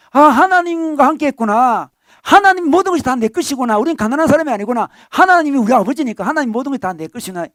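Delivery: joyful realization. Acted. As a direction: exclaim it like a sudden happy insight. Believer's joyful realization voiced.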